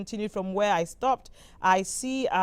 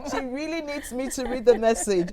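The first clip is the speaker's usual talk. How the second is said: laughing; 3 semitones higher than usual. Real, not acted. Host reads laughing viewer.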